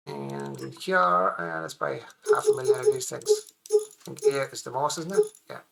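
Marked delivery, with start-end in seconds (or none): 2.3-3.8 squeaky high voice. Pretend. Joking about huge purchase.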